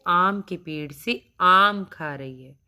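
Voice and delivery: ordinary talk, nothing marked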